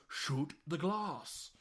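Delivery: very slow, spelled-out command; slow deliberate order. Retold or imitated. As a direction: say it slowly like to someone who doesn't get it. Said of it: "And he goes".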